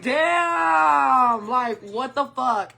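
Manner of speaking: high, drawn-out cry of disbelief; shocked outburst. Retold or imitated. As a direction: stretch it out in mock shock. Retelling shock at bitten food.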